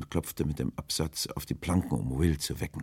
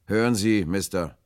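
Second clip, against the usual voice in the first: loud, raised address; Will calling firmly; 5 semitones higher, 9 dB louder than usual. A narrator's reading. Will addresses the stranger over the rail, loud and firm. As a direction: call out to get his attention.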